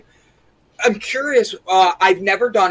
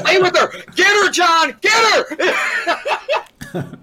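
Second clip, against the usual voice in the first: high, laughing egging on; excited cheering; 11 semitones higher than usual. Real, not acted. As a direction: egg them on gleefully. Cheering on a playful tease.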